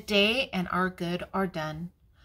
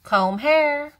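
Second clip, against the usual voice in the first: louder and higher, a firm reminder; firm mama voice; 7 semitones higher, 8 dB louder than usual. Acted. Grandma reading, Mama telling the child again to comb hair, firm. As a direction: repeat the order a little firmer.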